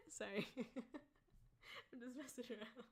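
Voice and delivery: soft, slow laughing apology. Real, not acted. Apologizing to chat, soft laughing apology.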